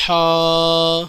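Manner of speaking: ہا as a throat h with long aa. Shown as a demonstration. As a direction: push ہا from the throat and hold it. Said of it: "حلق کے اوپری حصے سے پڑھا جاتا ہے".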